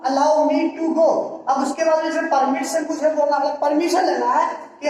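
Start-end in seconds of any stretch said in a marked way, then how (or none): 0.0-1.1 very slow, words broken apart with pauses; slow dictation. Shown as a demonstration. Teacher dictating the English translation to students, very slowly. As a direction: dictate it word by word. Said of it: "जो मैं आपको बोल रहा हूँ".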